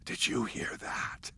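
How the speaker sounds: hushed, voiceless question; fake-scared whisper; spooky whisper. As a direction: whisper it like something's out there. Kid faking fear in woods.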